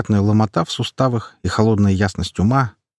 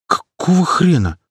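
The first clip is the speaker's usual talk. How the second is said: high, slow disbelieving cry; startled outburst; 9 semitones higher, 3 dB louder, slower than usual. A narrator's reading. Waking hero shocked to see Klepan instead. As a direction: blurt it out in shock.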